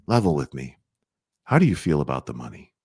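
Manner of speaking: low, calm, direct money question; level with them calmly; low calm question about money; frank, gentle ask. Shown as a demonstration. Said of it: "That's how you bring it up".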